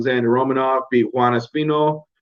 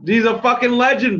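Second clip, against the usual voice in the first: He's a fucking legend, fucking pitched much higher; excited emphasis; 10 semitones higher than usual. Real, not acted. Praising Arlovski to viewers, excited emphasis. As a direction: lift it up in pure admiration. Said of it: "Dude, he's a fucking legend".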